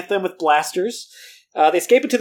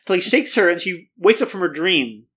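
usual speaking voice

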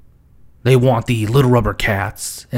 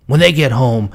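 usual speaking voice

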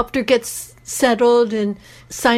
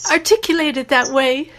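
ordinary talk, nothing marked

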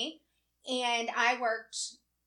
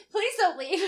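higher, louder pleading repeat; desperate pleading; 6 semitones higher, 5 dB louder than usual. Retold or imitated. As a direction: beg them to stay, more urgently. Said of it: "trying to cover his ass".

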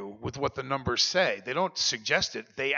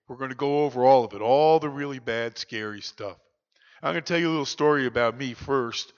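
Bob Timmons; 5 dB louder than usual. Retold or imitated. Sponsor opening up to Daniel at the table, odd frank voice. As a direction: talk like the strange, unshockable sponsor. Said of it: "I do a spot-on impression of Bob".

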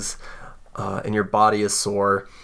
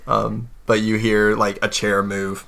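normal talking voice

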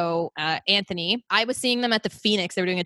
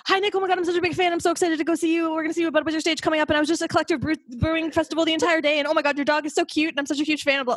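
higher, fast starstruck babbling; gushing word vomit; 8 semitones higher, faster than usual. Retold or imitated. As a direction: blurt it all out to your idol in one breath. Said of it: "comes out like word vomit".

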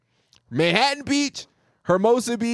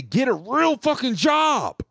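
mock yelled insult; 5 semitones higher, slower than usual. Caller's parting insult to the telemarketer, slow mock yell. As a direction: yell it slowly in a mocking voice.

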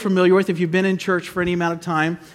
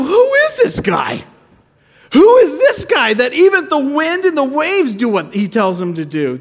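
high, astonished outburst, then falling; acted awe; 7 semitones higher, 4 dB louder than usual. Disciples looking at Jesus, acted astonished awe. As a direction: gasp out the question in mock amazement. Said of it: "now they're not terrified of the storm".

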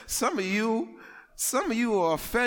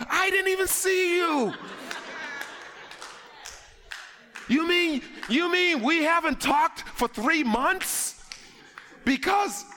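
incredulous protest; 8 semitones higher than usual. Retold or imitated. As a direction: protest your innocence, outraged. Acted quarrel with offended friend.

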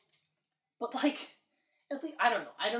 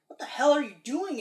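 retold angry dad; 6 semitones higher, 8 dB louder than usual. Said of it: "and his dad's like".

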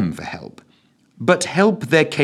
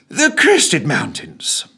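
breathless; 8 semitones higher, 4 dB louder, much slower than usual. Acted. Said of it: "uttering breathlessly".

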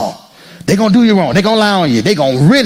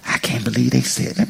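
muttered quote; 7 semitones lower, 8 dB quieter than usual. Retold or imitated. Churchgoer voiced quietly.

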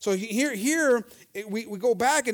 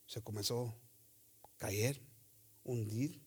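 soft, low, broken by pauses; low preacher's hush; hushed dramatic telling; 10 semitones lower, 12 dB quieter than usual. A narrator's reading. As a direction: let the voice sink with each word. Preacher dramatizes Peter falling, sinking.

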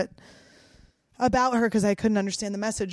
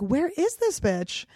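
exasperated search; 7 semitones higher, slower than usual. Retold or imitated. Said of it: "I'm like".

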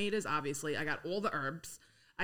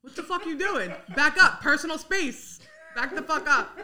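higher and louder, a hammed-up Queens blow-up at a pushy shopper; mock angry outburst; 7 semitones higher, 8 dB louder than usual. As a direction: snap at someone bumping your cart. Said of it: "That's what I would say".

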